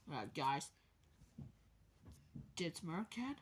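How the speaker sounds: ordinary talk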